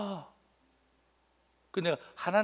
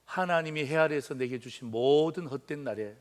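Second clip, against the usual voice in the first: usual speaking voice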